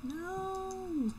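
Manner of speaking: high, sharp cry of dismay. Real, not acted. Player yelping at a game mistake, dismayed. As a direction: yelp at the mistake.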